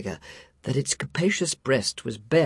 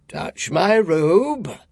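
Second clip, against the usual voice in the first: slow, loud booming giant spirit; echoing ethereal energy being; 5 semitones higher, 5 dB louder, much slower than usual. A narrator's reading. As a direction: boom it out like a jolly giant. Ghost commands.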